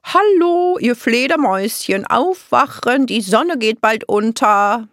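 high, loud sing-out wake-up call. A narrator's reading. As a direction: call out brightly to sleepers, stretching the words. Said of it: "rief eine Stimme".

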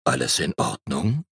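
low, deep bear voice; low-pitched gentle question. A narrator's reading. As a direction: ask it from deep down in a low register. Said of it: "sagte eine tiefe Stimme".

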